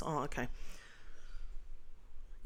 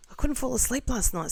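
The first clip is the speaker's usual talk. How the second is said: usual speaking voice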